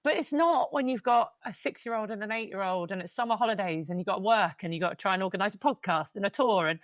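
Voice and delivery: ordinary talk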